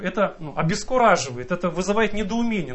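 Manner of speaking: usual speaking voice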